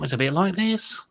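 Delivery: rolling West Country voice, like Long John Silver; West Country accent. Shown as a demonstration. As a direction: drop into a thick West Country pirate accent. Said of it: "go into my natural accent".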